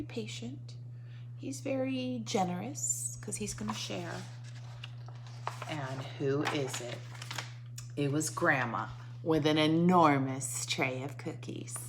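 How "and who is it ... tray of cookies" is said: normal talking voice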